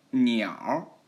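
slow 鸟 curled into "niaor"; erhua ending. Shown as a demonstration. Teacher isolates the erhua syllable, slow curled r on 鸟. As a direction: draw out 鸟 and curl the tongue into an r. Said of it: "알화 붙여서".

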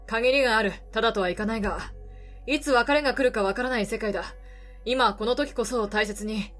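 put-on gruff weary older man, low. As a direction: speak low like a tired drifter. Voices the reporter.

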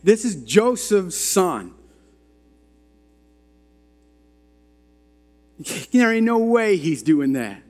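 high, drawn-out mock disbelief; scoffing townspeople. Townspeople doubting Joseph's son, scoffing high and slow. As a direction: act out a crowd dismissing him, pitched high. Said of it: "They were like".

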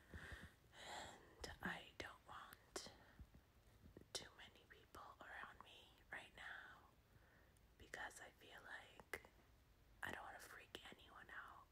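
very soft breathy whisper; barely audible whisper. Real, not acted. Whispering so others won't worry.

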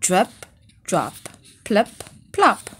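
drip-drop sound effect voice; playful rain sounds. A narrator's reading. Reading rain sounds aloud to children, playful. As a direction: voice the raindrops playfully.

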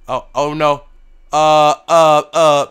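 loud, frantic mayday calls; mock panic. Crashing pilot shouting mayday in hammed-up panic. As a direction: cry out as the plane falls. Plane going down.

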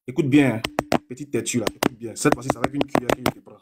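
quiet, condescending, the last line rushed; soft mocking taunt. Real, not acted. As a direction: drop to a hushed, teasing jab at the opponent.

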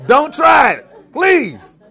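slow, loud laughing plea; laughing shouted warning. Real, not acted. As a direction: beg them loudly not to try it. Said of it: "warning kids not to try".

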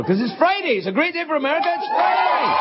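high, shouted Friday celebration; hyped shout about Friday; excited cheer. Real, not acted. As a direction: yell it like the weekend just started.

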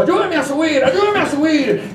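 high-pitched, urgent begging; eager pleading. Acted. Envoy begging the boy to help him mount, eager pleading.

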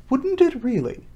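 high-pitched astonished question; great surprise. A narrator's reading. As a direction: ask it amazed, voice leaping up. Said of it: "in a tone of great surprise".

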